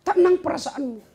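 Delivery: high exclaim. Acted. Preacher exclaims peace of mind.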